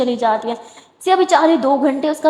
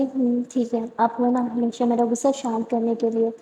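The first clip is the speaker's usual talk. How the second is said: softer, gentle reply; soft contentment; 6 dB quieter than usual. Acted. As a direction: let the anger melt away. Sara softened.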